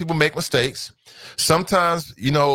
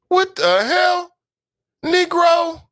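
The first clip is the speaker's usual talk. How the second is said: incredulous; 14 semitones higher, much slower than usual. Real, not acted. Outraged at politician's video.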